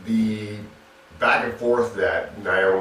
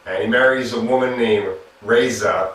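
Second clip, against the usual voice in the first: ordinary talk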